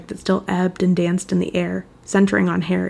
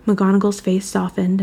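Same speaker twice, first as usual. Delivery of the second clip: ordinary delivery, nothing marked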